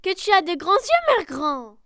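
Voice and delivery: plus haut et plus fort, émerveillée; s'exclame, surprise; étonnée. Acted. L'enfant s'étonne devant le loup. (Chaperon Rouge s'étonne, fort et aigu)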